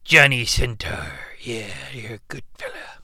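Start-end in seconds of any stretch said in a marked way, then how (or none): 1.4-3.0 hushed aside; breathy whisper to the kid. Acted. Aside to Johnny.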